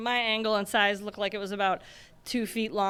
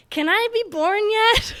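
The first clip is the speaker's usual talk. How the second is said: put-on childlike plea; 11 semitones higher, 5 dB louder than usual. Little kid pleading to sisters.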